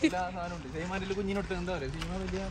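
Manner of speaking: hushed. Real, not acted. Baby asleep in car.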